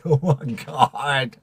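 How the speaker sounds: slow, drawn-out, near-tearful exclamation; overwhelmed disbelief. Real, not acted. Streamer floored by another gift, overwhelmed and close to crying. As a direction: stretch it out, overcome.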